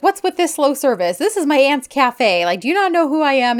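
crazy demanding customer. Retold or imitated. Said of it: "she just winds up being crazy".

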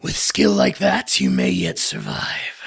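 low, grave lord with whispered asides; gruff warrior lord. Acted. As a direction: speak as a proud, grudging warlord. NPC ominous closing line.

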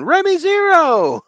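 high, drawn-out stage shout; concert announcer. Acted. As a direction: announce the band with a long, loud holler. Announcing band at imagined concert.

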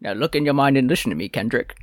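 fast British butler voice; hint of British butler; Michael Caine voice. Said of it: "Michael Caine voice".

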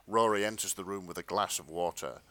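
ordinary talk

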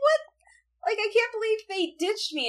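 high whiny mock Usagi voice; a lightly voiced sulky teen girl. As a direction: play her hurt and indignant, up high. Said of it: "and she's sad".

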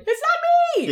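high, frantic yelp of innocence; panicked denial. Acted. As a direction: squeal it out in panic.

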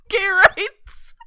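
high, loud laughing shout; laughing cheer. Real, not acted.

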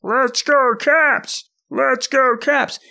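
high-pitched, drawn-out arena holler; bellowing fan yell. Retold or imitated. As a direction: bellow it like a fan heard over the whole crowd. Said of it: "this dude will start yelling".

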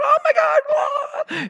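high-pitched hammed-up squealing; hyper excitement. Preacher imitating church people running around, hyper high squeal. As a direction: shriek like an overexcited crowd. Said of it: "all these hyper people".

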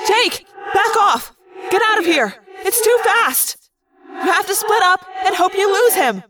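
high, pressing command; urgent shout. Acted. Rachel ordering Jake to flee, high urgent shout. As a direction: push him away, fast.

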